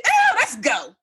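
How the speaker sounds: very high mock-alarmed cry; panicked exit. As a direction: squeal it high, eager to get away. Urges friend to flee, laughing.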